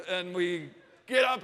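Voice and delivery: usual speaking voice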